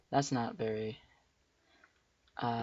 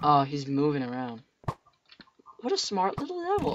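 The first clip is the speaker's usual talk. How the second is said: usual speaking voice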